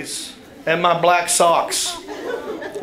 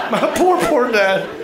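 laughing pity; 7 semitones higher than usual. Real, not acted.

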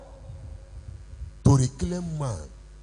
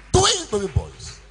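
jubilant repeat; 11 semitones higher, 3 dB louder than usual. Acted. Repeats the miracle.

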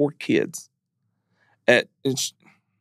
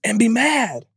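much higher, drawn-out grieving cry; real anguish; 10 semitones higher, slower than usual. Real, not acted. Husband feeling guilt over his wife's packed things, high and anguished. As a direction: let the grief push the voice way up, slowly.

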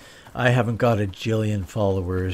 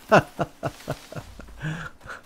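breathy chuckle at own joke; laughing; 6 semitones higher than usual. Real, not acted.

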